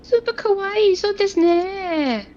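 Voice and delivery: high, slow squeaky kawaii voice; a player hams up a cutesy anime voice; mock anime girl. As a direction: gush like a cartoon schoolgirl. Mock anime cute talk.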